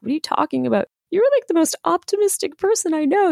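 shocked friend. Retold or imitated. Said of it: "She was like".

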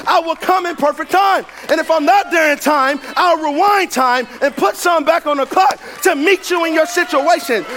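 high, driving sermon climax; full-force preaching. Real, not acted. Preacher urging the congregation not to quit, full force.

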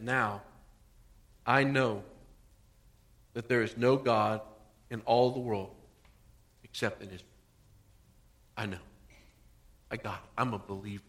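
a quiet, humbled believer; awed confession. Retold or imitated. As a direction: hush into quiet wonder. Healed Naaman confesses belief.

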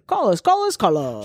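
booming hype announcer, pitch swooping; monster truck ad. Pretend. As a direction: shout it like a cheesy truck ad. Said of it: "like, monster truck commercial".

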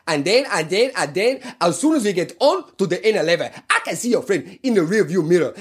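high-pitched imitation of a Nigerian man's accent; Nigerian Pidgin English accent. Taxi driver scolding Irish boys, in a mock Nigerian accent. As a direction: rant in a put-on Nigerian accent, pitched well up. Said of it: "a pidgin English accent".

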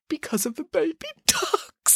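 high, slow, overwhelmed voice; overcome gushing. Acted. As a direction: draw it out, hammed-up and overcome. Boris overcome by baby ducks.

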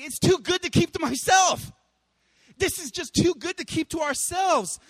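high, loud, bursting with delight; overflowing joy. Retold or imitated. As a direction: shout it like it can't be contained. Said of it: "it's saying".

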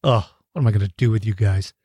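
low, quick fond sigh; loving exasperation. Real, not acted. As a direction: tease them fondly.

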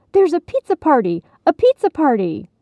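high, loud excited little mice shouting; excited kids. Acted. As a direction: blurt the news like thrilled children. Young brothers rushing over excited.

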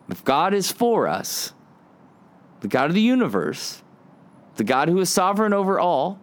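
preacher swelling on God's might; building preaching. Acted. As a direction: build it up line by line, then land it.

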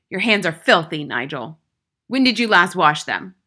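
loud, stern interrogation; domineering schoolmistress; tyrannical headmistress, demanding. A narrator's reading. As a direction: demand it sharply of the boy.

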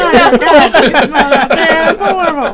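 loud, high retold horror through laughter; laughing exclamation. Co-host retelling how bad skunk smelled, laughing loudly. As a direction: exclaim it loudly while cracking up.

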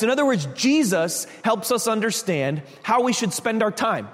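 usual speaking voice

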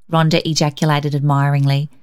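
ordinary delivery

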